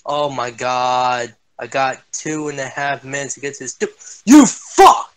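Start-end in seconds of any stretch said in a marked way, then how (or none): 3.8-5.1 high, loud yell at the screen; real rage outburst at teammate; furious shout. Real, not acted. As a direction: scream it out in gamer rage. Raging at teammate during game.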